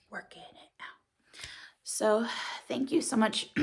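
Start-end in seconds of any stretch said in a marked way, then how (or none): 0.1-1.5 breathy whisper, very soft. Real, not acted. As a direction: whisper it to yourself. Quiet determined aside.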